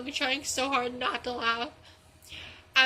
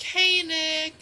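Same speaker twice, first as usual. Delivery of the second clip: higher, louder mock-innocent hello; playful greeting; 4 semitones higher, 5 dB louder than usual. Prankster greeting the fooled friend, playful. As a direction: call out a lilting hello.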